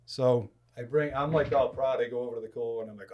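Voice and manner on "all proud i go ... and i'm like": ordinary talk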